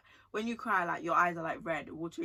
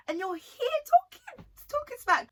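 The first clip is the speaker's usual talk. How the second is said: very high, loud outrage; indignant squeal; 5 dB louder than usual. Real, not acted. Host scolding guests who insult people in their house, squealing indignantly. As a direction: let indignation push the voice right up high.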